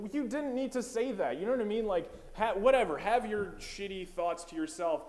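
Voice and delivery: high loud disbelief; exasperated rant. Acted. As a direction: scold him, fed up. Ranting at Chick-fil-A guy.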